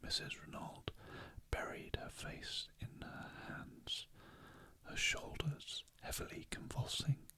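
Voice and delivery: whispered bedtime audiobook narration; whispered story reading. A narrator's reading. Reader lulling listeners to sleep with a mystery chapter, whispering. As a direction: read the story in an unhurried whisper.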